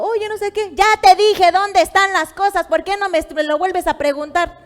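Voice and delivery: loud, high-pitched scolding; irate wife. Acted. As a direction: snap at the husband in exasperation. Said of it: "me empiezo a irar".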